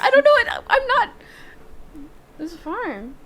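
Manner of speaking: high giggly voice through laughter; laughing. Real, not acted. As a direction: giggle out the words. Laughing at own garage sale reveal.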